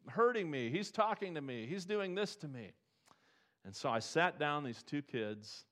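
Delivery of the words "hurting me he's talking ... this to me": high, loud, whining complaint; whiny bully crying foul. Retold or imitated. Bully whining to the bus driver about being hurt, high and loud. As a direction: whine it like a kid tattling to the teacher. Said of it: "the bully cries foul".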